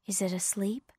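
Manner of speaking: put-on softly, so the child stays asleep; hushed. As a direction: keep it down near a sleeper. Parent checks on sleeping baby.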